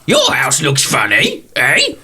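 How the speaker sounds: put-on old dear repeating it slowly, a bit miffed; tottery old lady. Old lady repeating the orc's remark, high and slow. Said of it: "this tottery old lady".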